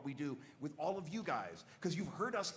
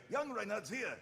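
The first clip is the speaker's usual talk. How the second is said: pitched-up younger knight; higher, in the top of the voice; young Reinhardt; 6 semitones higher than usual. Shown as a demonstration. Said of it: "You pitch it up in the top of the voice".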